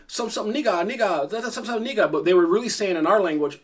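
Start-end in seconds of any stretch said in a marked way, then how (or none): 0.1-2.1 imitating Chinese speakers. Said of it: "they kept saying".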